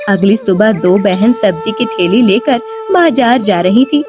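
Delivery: ordinary delivery, nothing marked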